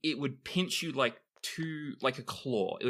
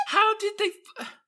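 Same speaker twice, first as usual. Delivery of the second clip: laughing disbelief; 5 dB louder, faster than usual. Real, not acted.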